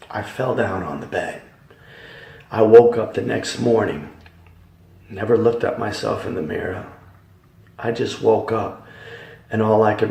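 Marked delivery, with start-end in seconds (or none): none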